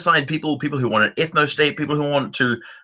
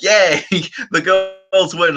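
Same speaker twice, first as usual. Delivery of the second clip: higher, laughing little cheer; mock cheer; 6 semitones higher, 3 dB louder than usual. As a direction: cheer like a cartoon caption. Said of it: "it's like".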